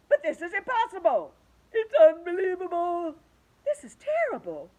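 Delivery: loud, high shocked wails; horrified at the open sea; aghast cries. Acted. The creatures cry out at the sea, loud and horrified.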